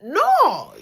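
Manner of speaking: sharp loud NO; snapping back at brother, loud. Retold or imitated. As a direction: shut him down loud. Retold argument with brother.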